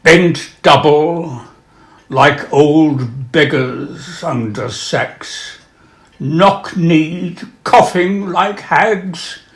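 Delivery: solemn reading of a war poem; poem recitation. Acted. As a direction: read it slow and haunted, like a witness. Reads Owen's war poem aloud.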